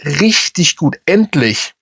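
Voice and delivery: endlich drawn out and set apart for emphasis; stress on endlich. Real, not acted. Fan venting about the team finally playing well, stress on endlich. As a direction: lean on the word endlich, slowly. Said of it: "da liegt die Betonung auf endlich".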